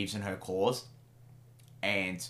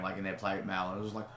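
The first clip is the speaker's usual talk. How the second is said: usual speaking voice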